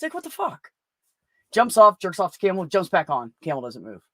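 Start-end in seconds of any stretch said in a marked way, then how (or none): 0.0-0.7 high, startled what the fuck; sudden high-pitched disbelief. Retold or imitated. Rider stunned his camel stopped again, high disbelief.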